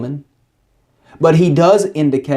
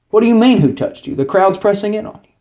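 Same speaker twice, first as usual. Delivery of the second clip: incredulous disciples; 7 semitones higher, faster than usual. Retold or imitated. Disciples can't believe the question.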